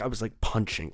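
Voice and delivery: usual speaking voice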